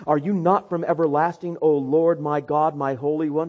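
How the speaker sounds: full-force sermon delivery; preach. Acted. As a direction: preach it out to the congregation. Prophet's prayer, proclaimed.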